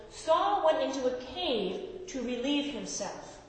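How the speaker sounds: measured Bible reading voice; scripture reading. Acted. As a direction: read the passage to the congregation. Reads scripture aloud.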